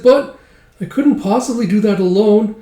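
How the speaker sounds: high, squeaky protest; high-pitched acted dismay; helpless elf. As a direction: object plaintively as a small sidekick.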